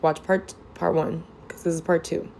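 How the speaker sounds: normal talking voice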